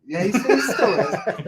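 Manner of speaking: laughing. Real, not acted. Laughing about Teddy.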